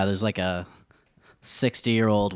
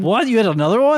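shocked disbelief; 11 semitones higher, 6 dB louder than usual. Real, not acted. Shocked at second ankle gun.

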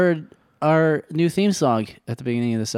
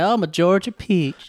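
put-on higher-pitched Georgia belle accent; Georgia debutante; 7 semitones higher than usual. Co-host feeding debutante lines, high Georgia accent. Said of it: "Can you do a debutante?".

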